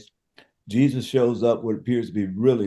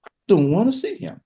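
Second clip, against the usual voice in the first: higher, hurt refusal; Mary refusing again, higher; 7 semitones higher than usual. Retold or imitated. Mary to Jesus, turning him away, higher and hurt. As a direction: lift the pitch with wounded bitterness. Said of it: "It's like".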